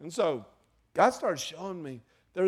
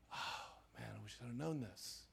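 whispered, much softer lament; regretful whisper; 4 semitones lower, 14 dB quieter than usual. Retold or imitated. Father looking back, whispering his regret. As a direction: breathe it out in regret.

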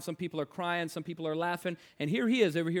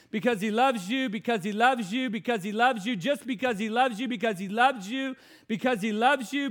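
repeated loving assurance; 3 semitones higher, 5 dB louder than usual. Retold or imitated. Telling boy God loves him.

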